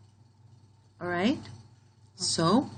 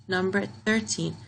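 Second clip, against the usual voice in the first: usual speaking voice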